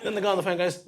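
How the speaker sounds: ordinary delivery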